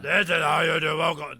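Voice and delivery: loud shouty Muppet voice. Shown as a demonstration. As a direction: yell it like a Muppet. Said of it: "you just sound kinda like a Muppet".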